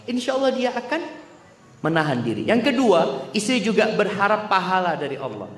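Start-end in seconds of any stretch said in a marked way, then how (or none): none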